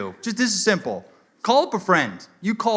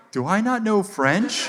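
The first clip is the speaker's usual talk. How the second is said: higher, slow mock self-doubt; doubting himself; 6 semitones higher, slower than usual. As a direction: imitate a Frenchman questioning his own French. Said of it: "I could see the doubt on his face".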